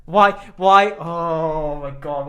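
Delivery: loud, drawn-out disbelief; can't believe what you heard; incredulous. Real, not acted. Reacting to silly bird-call idea.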